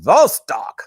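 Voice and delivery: Vostok in a mock Russian accent. Shown as a demonstration. Showing viewers how a Russian says it, in a Russian accent. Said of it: "You can say it like a Russian accent".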